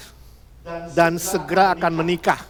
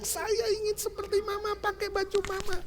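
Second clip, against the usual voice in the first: put-on high, soft girlish voice; daughter's voice; 14 semitones higher, 6 dB quieter than usual. Preacher voicing Betty telling her mother, high and soft. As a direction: sound like an eager young bride. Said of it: "Dia bilang".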